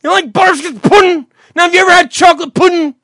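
high, hammed-up yelling; screaming. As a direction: holler it like a loud drunk at full volume.

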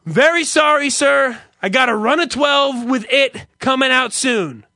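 high, loud, overly polite manager apology; mock manager. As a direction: play the self-important manager soothing the customer. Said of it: "So the manager comes by".

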